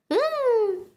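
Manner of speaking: high whoop of joy; cheer. Acted. Cheering in the scene.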